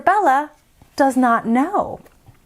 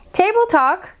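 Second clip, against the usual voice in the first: plain reading voice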